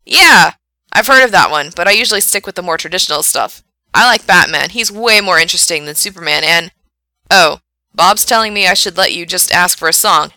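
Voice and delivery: loud, eager on-air chat; chatty radio DJ. Acted. Frank talks comics with his caller, loud DJ voice. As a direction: burst in brightly, then banter on.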